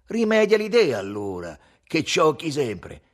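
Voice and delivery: loud, high comeback; angry retort. A narrator's reading. Quarrel retort between the mates.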